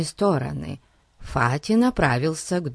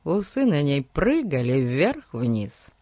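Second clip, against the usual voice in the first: plain reading voice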